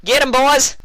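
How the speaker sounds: high, loud order to charge; rousing loud command; shout. A narrator's reading. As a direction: yell the charge. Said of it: "Applejack shouted".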